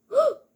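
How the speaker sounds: loud startled gasp; sharp gasp. Real, not acted. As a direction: gasp in sudden shock. Sudden shock in game.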